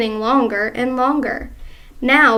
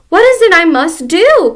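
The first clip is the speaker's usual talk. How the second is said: higher, much louder plea; frantic cry; 6 semitones higher, 10 dB louder than usual. Acted. Wife pleading with husband, higher, much louder. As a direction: cry out clutching her cheeks. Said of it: "cried Missus Twit".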